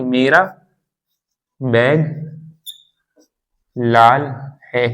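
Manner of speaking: spaced out with long pauses between words. Shown as a demonstration. Teacher giving a beginner an example sentence, word by word. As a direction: say each word separately, leaving long gaps.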